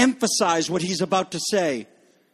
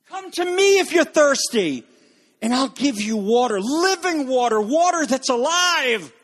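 higher, urgent invitation to come and drink; Jesus calls out, offering living water; voicing Jesus; 6 semitones higher than usual. Jesus quoted inviting the thirsty.

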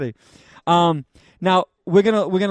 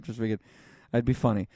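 ordinary delivery

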